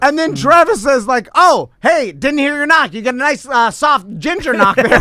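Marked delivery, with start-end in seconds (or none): 1.3-4.9 high-pitched, friendly imitated greeting; imitating polite greeting. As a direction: lightly mimic a polite hello at the door. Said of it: "he's just polite".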